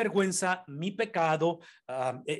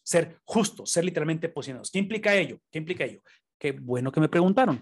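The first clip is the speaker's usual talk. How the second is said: ordinary talk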